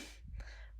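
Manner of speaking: short, quiet throat clear; awkward little throat-clearing. Acted. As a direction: clear your throat to cover a slip. Catches own slip over a name.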